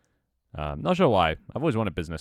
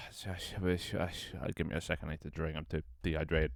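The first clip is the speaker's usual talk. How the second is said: gasping, stuttering and much softer; out of breath; 8 dB quieter than usual. Real, not acted. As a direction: catch your breath between broken starts. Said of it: "I'm out of breath".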